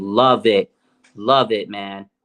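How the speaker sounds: ordinary delivery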